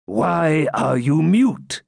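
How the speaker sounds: Grand Master demanding a reply sternly. A narrator's reading. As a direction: press him for an answer. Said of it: "continued the Grand Master".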